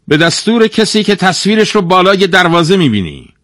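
high, loud guard character voice; loyal gate guard. A narrator's reading. Gate guard praising the town's founder to a stranger, emphatic.

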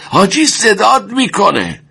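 high shouted warning; urgent shout. A narrator's reading. Wounded friend shouting that the commander is calling, high. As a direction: shout at him to go. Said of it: "فریاد کشید".

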